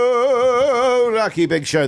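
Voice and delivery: high, drawn-out show intro; radio show opener. Real, not acted. Host welcomes listeners back to the show, stretched announcer call. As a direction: stretch the show's name out high and slow.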